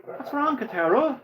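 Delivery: high, sing-song gloating question; mocking taunt. Gloating over the beaten Kentaro, mocking high taunt.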